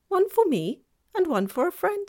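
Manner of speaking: a cheerful faun showing off his cave; faun's voice. A narrator's reading.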